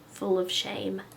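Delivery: usual speaking voice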